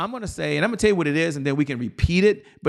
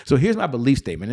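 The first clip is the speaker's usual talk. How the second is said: ordinary talk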